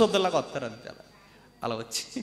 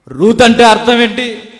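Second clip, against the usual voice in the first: Ruth అంటే అర్థం ఏంటి asked higher and louder; loud repeated question; 8 semitones higher, 15 dB louder than usual. Shown as a demonstration. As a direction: fire the question at the crowd.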